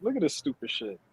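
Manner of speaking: ordinary talk, nothing marked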